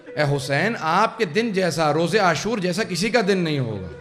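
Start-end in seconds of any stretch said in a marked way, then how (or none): none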